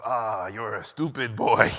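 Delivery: put-on low, slow Hungarian-accented scolding; exasperated sigh of an insult, Hungarian accent. Hungarian mentor chiding a naive student, low slow accent. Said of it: "thick accent".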